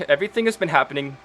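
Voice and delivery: halting, breaking voice as Penelope; tearful. Acted. Penelope answering Iker with tears, halting and upset. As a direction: hold back tears between words. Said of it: "and she just says".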